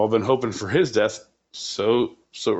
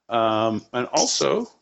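usual speaking voice

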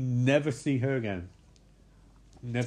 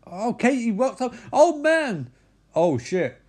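startled; 8 semitones higher, 5 dB louder, slower than usual. Retold or imitated. Sudden movement on camera, reaction.

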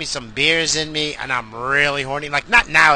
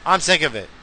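ordinary delivery

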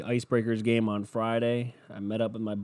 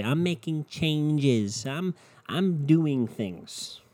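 slow, higher-pitched mock boasting; mock someone kidding themselves; mocking self-deception; 5 semitones higher, much slower than usual. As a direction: drag out the phony claim in a higher voice. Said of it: "continuing to lie to yourself and say".

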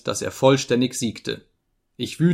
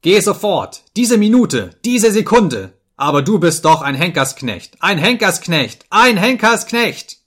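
loud, high frenzy of rage; screamed abuse; 7 semitones higher, 7 dB louder than usual. A narrator's reading. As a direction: fire off orders, then hammer the insult. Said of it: "schrie ich".

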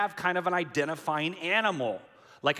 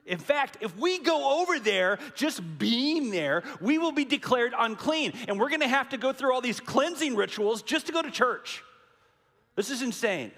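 high, louder panicked disciples; alarmed protest; 7 semitones higher, 4 dB louder than usual. Retold or imitated. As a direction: rattle off the objections in mock alarm. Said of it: "This is what they're thinking".

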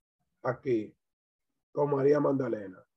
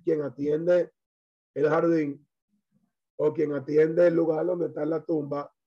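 ordinary talk, nothing marked

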